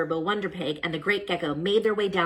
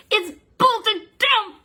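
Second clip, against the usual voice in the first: high, slow, effortful grunt; grunting strain; 7 dB louder, much slower than usual. Acted. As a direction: push through gritted teeth. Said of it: "grunted Super Turbo".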